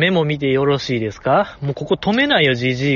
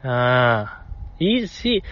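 usual speaking voice

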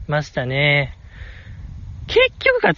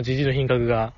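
normal talking voice

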